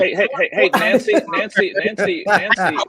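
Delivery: loud laughing call for attention; hey Nancy, shouted through laughter. Real, not acted.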